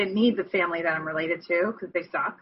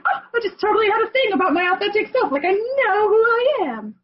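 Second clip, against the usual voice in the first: excited realization; 13 semitones higher, 4 dB louder than usual. Retold or imitated. Retold excited reply to Fawn.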